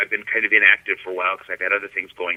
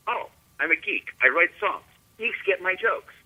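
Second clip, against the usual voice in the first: happy realization; 9 semitones higher than usual. Retold or imitated. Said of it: "and realized".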